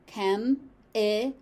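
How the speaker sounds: chem-i-col in three separate syllables; slow chem-i-col, each syllable apart. Shown as a demonstration.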